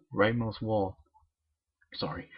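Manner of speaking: ordinary delivery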